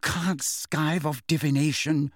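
slow, measured reprimand; mildly stern scolding; severe. A narrator's reading. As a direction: lay down the rule sternly, drawing it out. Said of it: "said Hermione severely".

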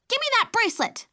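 put-on high, loud toddler shout; a tiny girl in a rage; furious shout. A narrator's reading. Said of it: "she shouted in fury".

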